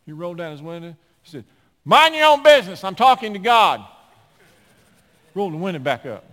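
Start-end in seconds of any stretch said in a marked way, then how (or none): 1.8-3.8 much louder, high, telling her off; loud snap. Retold or imitated. Driver snapping at staring lady, loud. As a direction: bark it out the car window.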